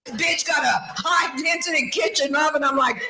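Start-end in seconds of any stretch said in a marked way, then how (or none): none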